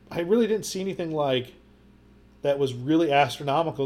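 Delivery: usual speaking voice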